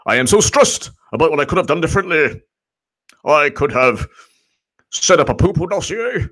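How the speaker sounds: high put-on caricature voice; hammed-up impression, pitched well up; John Brennan. FBI attorney's stressed text read as an ex-official, high caricature. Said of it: "in John Brennan's voice".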